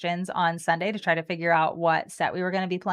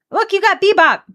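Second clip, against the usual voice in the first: excited; 11 semitones higher, 9 dB louder than usual. Real, not acted. Excited at opponent's card.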